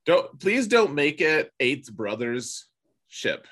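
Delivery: usual speaking voice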